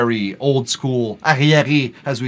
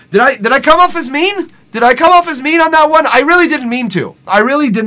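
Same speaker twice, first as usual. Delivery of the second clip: high, louder anxious question; worried; 11 semitones higher, 5 dB louder than usual. Real, not acted. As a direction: ask it with sudden worry. Worried he sounded mean.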